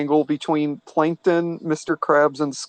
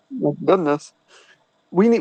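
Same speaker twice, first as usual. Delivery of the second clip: ordinary talk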